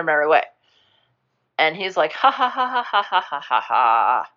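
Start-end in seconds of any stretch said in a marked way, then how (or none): 2.1-4.3 staccato fake laugh, dropping in pitch at the end; mock laugh. Retelling how he laughs in the story, mock laugh.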